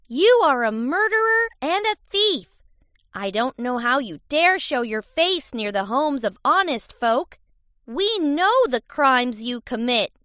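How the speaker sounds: put-on high, loud accusations from a cocky kid; taunting kid. A narrator's reading. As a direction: taunt an enemy from up on the roof. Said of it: "began to tease and taunt the wolf".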